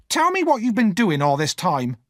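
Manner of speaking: high, loud order; scolding demand. A narrator's reading. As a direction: bark it down at the child. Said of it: "Missus Joe cried".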